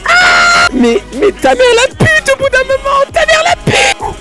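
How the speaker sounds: high, loud exasperated scream; exasperated yell. Real, not acted. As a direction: blow up in disbelief. Exasperated outburst.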